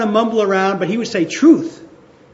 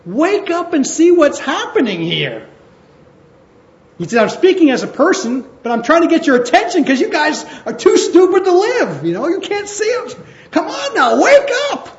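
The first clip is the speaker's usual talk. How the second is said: higher, louder exasperated plea; urgent wake-up call; 9 semitones higher, 3 dB louder than usual. Retold or imitated. Paul rousing the people, urgent exasperated call. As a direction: shake them awake, rising to a cry. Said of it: "And Paul said".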